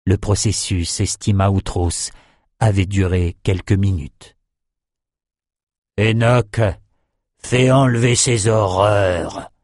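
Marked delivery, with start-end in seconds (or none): 6.0-9.5 slow, detached command; lightly voiced cold order. A narrator's reading. General coldly orders his second to remove the bodies. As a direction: dismiss it with slow disdain. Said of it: "ordonna Outros".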